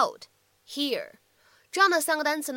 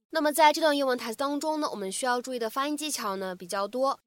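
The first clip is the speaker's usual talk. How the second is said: ordinary talk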